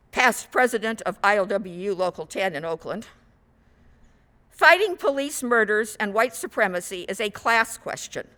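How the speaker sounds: ordinary talk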